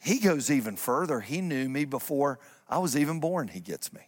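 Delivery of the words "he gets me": much lower, much softer intimate line; near whisper. Real, not acted. Pastor tenderly telling church, near whisper. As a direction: breathe it to them close.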